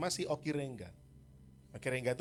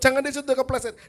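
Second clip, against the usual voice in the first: high, loud fed-up shout; exasperated yell; 11 semitones higher, 13 dB louder than usual. Retold or imitated. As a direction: shout it yet again, fed up. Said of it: "Umur tiga puluh lima tahun".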